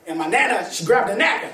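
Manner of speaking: ordinary delivery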